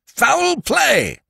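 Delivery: high, loud alarmed cry; cried out. A narrator's reading. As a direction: shout it out in shock. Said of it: "he cried".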